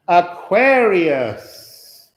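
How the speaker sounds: loud, long drawn-out announcement; calling out. Real, not acted. Reader announces the next sign to waiting viewers, calling out. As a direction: stretch it out loudly, pitch lifted.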